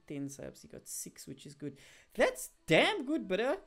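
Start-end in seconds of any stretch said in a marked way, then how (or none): 2.1-3.6 loud, high, insistent hype; emphatic praise. Real, not acted. Streamer defending Jared's six subs, emphatic praise. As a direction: really talk up the achievement, loudly.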